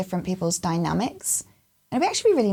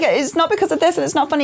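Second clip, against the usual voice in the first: tag=high-pitched protesting; 7 semitones higher than usual. Retold or imitated. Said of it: "and I'm not laughing".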